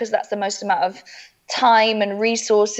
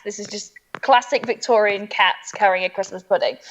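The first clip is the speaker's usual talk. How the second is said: ordinary delivery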